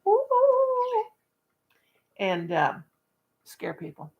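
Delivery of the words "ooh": high spooky ghost moan. Pretend. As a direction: moan like a ghost from behind a tombstone. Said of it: "hide behind a tombstone and go".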